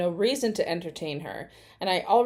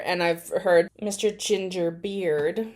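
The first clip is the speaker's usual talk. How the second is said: usual speaking voice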